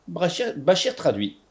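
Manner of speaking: plain reading voice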